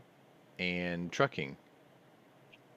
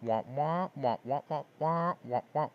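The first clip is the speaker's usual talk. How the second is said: higher-pitched wah-wah noise, like garbled talk; mimicked droning chatter; 8 semitones higher than usual. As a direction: mimic meaningless chatter as a string of wahs. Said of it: "Putin's just hearing".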